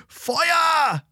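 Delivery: high alarmed cry of fire; shouting. Acted. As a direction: scream the warning. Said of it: "wie ein Schrei".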